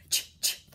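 breathy "Ch, ch" with no voice; just air for the ch; unvoiced ch sound. Shown as a demonstration. Teacher models letter sounds, voiceless. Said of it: "What's this one?".

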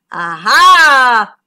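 loud, drawn-out aha; shark's discovery. A narrator's reading. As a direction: pounce on the find in triumph. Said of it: "thought the shark".

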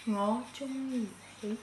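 slow, near-silent mouthing; stage whisper. Acted. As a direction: barely voice it, lips doing the work. Mouthing Cantonese phrase for guessing.

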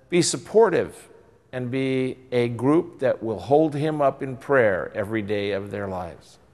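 ordinary talk, nothing marked

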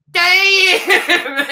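high, loud drawn-out exclamation; Chris Tucker shout. Retold or imitated. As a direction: belt it out like a stunned comic sidekick. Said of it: "they go".

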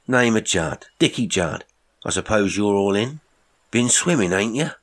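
put-on brash young Cockney sailor greeting a stranger; Cockney accent. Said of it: "He had a strong Cockney accent".

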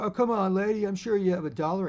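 high, loud wheedling beggar voice; pestering beggar. Retold or imitated. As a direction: nag her up high and loud. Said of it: "he starts following her".

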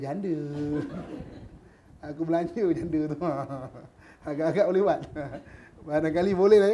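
amused, words broken by laughter; laughing while talking. Real, not acted. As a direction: chuckle through the words. Laughs while acting husband's excuse.

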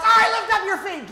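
loud, high, bossy order to the family; bossy shout. Acted. Mom ordering her family to lift their feet, loud and bossy. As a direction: bark it like a mom cleaning house. Bossing family while blowing house.

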